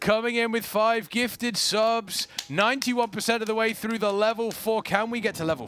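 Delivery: hoarse sleep deprived hype. Acted. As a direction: call out the subs like an announcer. Announcing subs.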